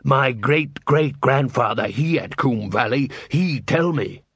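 slow, lumbering troll speech. Acted. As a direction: speak it slowly as a troll taunting back. Troll voice in novel reading.

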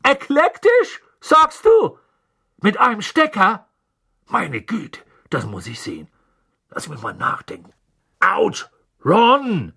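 high, excited fatherly voice; eager wizard dad voice. A narrator's reading. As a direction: play a curious, cheerful father. Mr Weasley voiced as character.

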